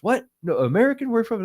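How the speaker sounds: high-pitched retold surprise; surprised monk. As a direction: imitate a startled monk on the phone.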